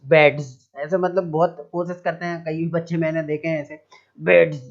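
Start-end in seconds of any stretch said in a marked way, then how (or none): 0.1-0.7 बेड्स with a hard D and a Z at the end, louder; wrong reading shown. Shown as a demonstration. Teacher mimics pupils misreading the word, a louder बेड्स. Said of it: "इस तरीके से नहीं पढ़ना". 4.2-4.7 बेड्स shoved out loud, the D hit hard; forced mock reading. Teacher mocks pupils straining over the word, a loud forced बेड्स. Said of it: "बेड्स में तो बहुत ताकत लगा रहे हैं".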